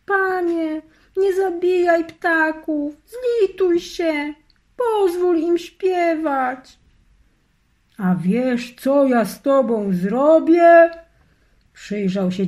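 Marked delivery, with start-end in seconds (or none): 0.0-6.8 put-on high, slow, begging voice of a girl; girl begging the wizard, tearful; pleading in tears. A narrator's reading. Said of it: "wołała z płaczem". 8.0-10.9 louder, rising threat; menacing wizard. A narrator's reading.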